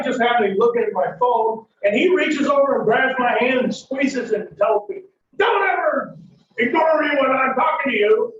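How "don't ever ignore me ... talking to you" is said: high-pitched shouted command; angry scolding. Acted. Driver grabbing his partner's hand, angry scolding. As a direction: snap it out as a raised-voice warning.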